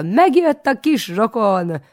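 high, loud, slow shriek. A narrator's reading. Aunt shrieks the news to market folk, high and loud. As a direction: trumpet it to everyone you pass. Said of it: "mindenkinek azt rikoltja".

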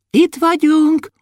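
very high, drawn-out call; gleeful dwarf. A narrator's reading. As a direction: pop out with a high sing-out. Hidden dwarf pops out calling. (magas, elnyújtott törpehang)

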